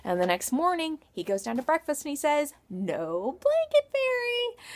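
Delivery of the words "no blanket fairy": put-on high, slow little-boy voice; child voice. A narrator's reading. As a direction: say it like a small disappointed child. Child announces at breakfast.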